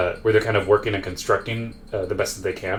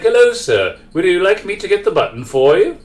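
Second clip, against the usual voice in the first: high, louder butler voice; mid-century butler; 9 semitones higher, 5 dB louder than usual. Acted. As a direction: offer service like an old-fashioned butler, pitched well up. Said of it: "like, butler. And he's kinda like".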